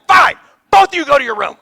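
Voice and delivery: loud fed-up shout; exasperated parent shout. Retold or imitated. Dad yelling at both fighting kids, loud exasperated. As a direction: snap them both off to their rooms. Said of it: "I'm like".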